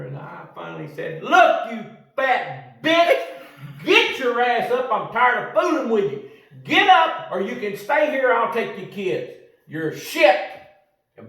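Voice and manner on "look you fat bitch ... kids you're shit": loud, high furious yelling at her. Retold or imitated. Cussing out the woman at the airport, loud and furious. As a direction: scream her up off the floor.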